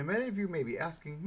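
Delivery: ordinary delivery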